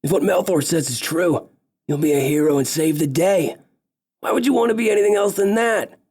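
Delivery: rising into a cheerful pep talk; optimistic big elf. Acted. Big elf telling Visas he'll be a hero, hearty optimistic elf voice.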